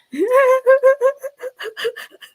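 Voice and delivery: high, loud burst of laughter. Real, not acted. Cracking up after the voice.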